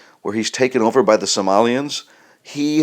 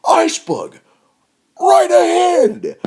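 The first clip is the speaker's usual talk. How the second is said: crying shriek, much higher, louder and slow; fearful scream; 4 dB louder, slower than usual. Pretend. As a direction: wail the warning in panic. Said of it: "It still sounds wrong".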